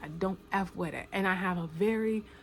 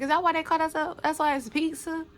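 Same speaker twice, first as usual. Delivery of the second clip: high, fast, incredulous; retold disbelief; 9 semitones higher, 4 dB louder than usual. Realizing what the party favors meant, high and fast. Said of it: "And I was like".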